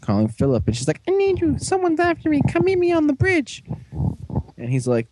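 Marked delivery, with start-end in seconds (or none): 1.1-3.6 very high falsetto voice; mock damsel plea. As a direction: put on a high-pitched plea for help. Voicing panicked caller in recap.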